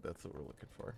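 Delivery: whispered aside. Real, not acted. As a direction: whisper it to yourself. Gaming, muttering to self.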